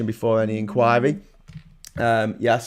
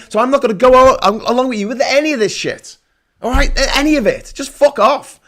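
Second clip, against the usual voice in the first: loud, much higher, exasperated tirade; pretend angry rant; 8 semitones higher, 6 dB louder than usual. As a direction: let the imagined opposition leader lose it. Opposition leader snaps back at Johnson.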